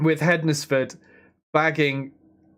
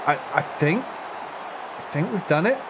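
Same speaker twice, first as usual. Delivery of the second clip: slow, soft, rising I think we've done it; hushed rising wonder; hopeful disbelief; 2 semitones higher, 3 dB quieter than usual. Real, not acted. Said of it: "slow rising disbelief".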